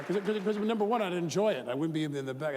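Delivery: ordinary delivery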